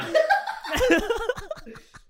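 high, loud laugh; laughing loudly; burst of laughter. Real, not acted. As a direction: crack up at yourself. Laughing at own claim.

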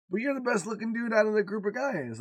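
daughter's voice. Retold or imitated. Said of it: "she goes".

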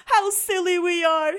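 loud high proclaim. Acted. Bruce proclaims to Cyril, loud. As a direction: proclaim it brightly. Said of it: "he proclaimed".

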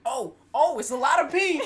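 high, loud laughing imitation; laughing impression of partner. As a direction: mimic him through laughter. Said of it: "he's like".

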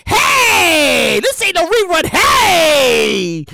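loud hype-man yell; hype shout. Retold or imitated. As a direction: shout it like an MTV host hyping a live show. Mock MTV hype, they're like.

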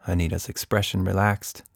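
usual speaking voice

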